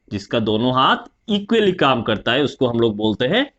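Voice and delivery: ordinary talk, nothing marked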